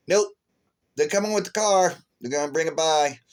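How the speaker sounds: loud, high quoted nope; emphatic nope. Retold or imitated. Answering the tired speaker, a loud high quoted refusal. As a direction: cut the wish short, loud and high. Someone answering his wish to rest.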